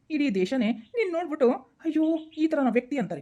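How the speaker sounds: ordinary talk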